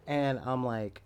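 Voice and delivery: ordinary talk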